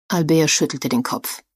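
ordinary delivery, nothing marked

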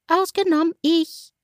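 a small cheeky bird cries out; tiny bird crying out. A narrator's reading. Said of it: "schrie ein kleiner Kerl". (hohe, piepsige Vogelstimme, herausrufend)

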